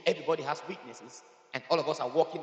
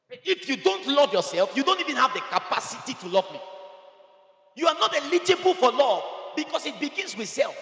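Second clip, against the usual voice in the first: loud, raised-pitch exhortation; full-force preaching; 6 semitones higher, 8 dB louder than usual. Real, not acted. Preacher urges listeners to love themselves first, forceful preaching. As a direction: drive it home to the congregation, loud.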